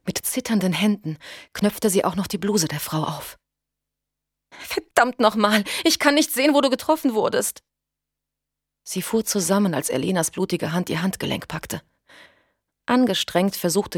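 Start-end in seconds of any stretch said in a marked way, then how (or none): 4.5-7.6 high, loud and frantic; frantic in rising panic; panicked. A narrator's reading. Panik bei der Wundsuche. (panisch, verzweifelt)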